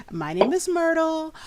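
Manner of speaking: high-voiced Myrtle. Game master voices hostess Myrtle greeting the party, high. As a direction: play Myrtle high and light.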